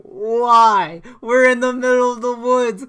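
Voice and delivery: loud incredulous yell; exasperated shout at a careless friend; retold yell. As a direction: throw the question at him, loud and high.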